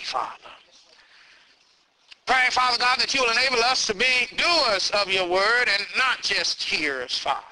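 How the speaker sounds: soft, hushed prayer. Acted. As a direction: pray quietly and humbly. Pastor leads closing prayer.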